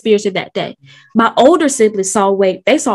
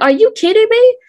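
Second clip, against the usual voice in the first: disbelief; 11 semitones higher than usual. Retold or imitated. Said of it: "And I'm like".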